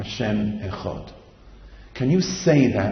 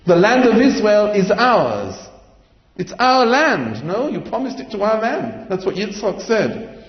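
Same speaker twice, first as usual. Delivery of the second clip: loud claim; 10 semitones higher, 5 dB louder than usual. Acted. Nations loudly claim the land.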